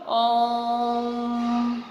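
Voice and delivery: ओम held on one steady tone for three beats; long-held Om. Shown as a demonstration. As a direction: stretch ओम out evenly like a chant. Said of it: "जैसे अब देख लो". (ओम को तीन मात्रा तक एक सुर में खींचें)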